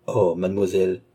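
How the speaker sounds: ordinary delivery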